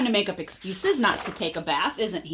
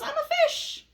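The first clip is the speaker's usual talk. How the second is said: much higher, playful fish voice. Acted. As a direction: pretend to be a fish. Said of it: "pretending to be a fish".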